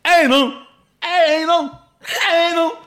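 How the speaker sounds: high, very loud mangled yell; frozen-faced shouting. Retold or imitated. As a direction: bellow it helplessly with a numbed mouth. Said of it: "standing in the middle of the pitch shouting".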